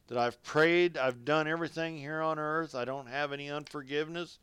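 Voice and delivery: ordinary talk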